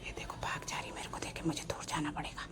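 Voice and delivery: real whisper near the nest; hushed whisper, bird close by. Real, not acted. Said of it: "मैं फिर से चुप हो जाती".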